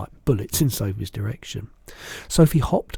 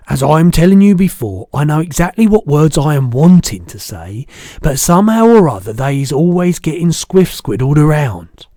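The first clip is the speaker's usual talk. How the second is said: loud, emphatic, higher giant voice; exasperated BFG; 4 semitones higher, 11 dB louder than usual. A narrator's reading. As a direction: complain loudly of a lifelong struggle.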